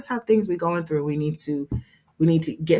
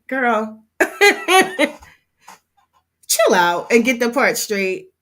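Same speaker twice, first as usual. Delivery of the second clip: laughing; 6 semitones higher, 5 dB louder, slower than usual. Retold or imitated. Retelling own reaction, laughing.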